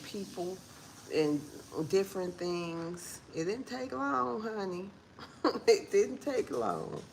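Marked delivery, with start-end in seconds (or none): 3.4-7.0 knowing laugh. Real, not acted.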